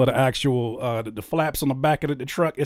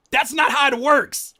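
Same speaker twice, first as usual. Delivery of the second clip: higher, louder scolding; lecturing the rapper angrily; rising shout; 11 semitones higher, 5 dB louder than usual. Real, not acted. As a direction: build to a shout. Heated scolding peak.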